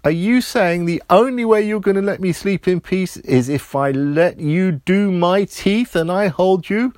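put-on resigned little boy. A narrator's reading. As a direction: ask the toothbrush, half giving in. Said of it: "Roger said".

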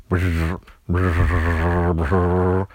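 low buzzing hum; toothbrush buzz. A narrator's reading. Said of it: "The toothbrush said".

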